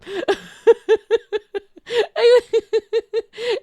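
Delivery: long, high, loud laughing fit; high-pitched overwhelmed laugh; emotional laughter. Real, not acted. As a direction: burst out laughing to hold back tears. Laughing while trying not to cry.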